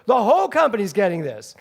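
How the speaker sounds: loud, high theatrical outcry; hammed-up diva shouting; mock outraged shout. Dolores Gray shouting at her castmate about the gift, mock indignant. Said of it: "She goes".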